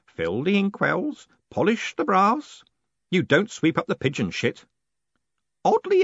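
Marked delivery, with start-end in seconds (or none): 0.0-2.6 put-on old man voice, raised, slow; creaky old clerk grumbling; lightly voiced old man; old postman Groat. A narrator's reading.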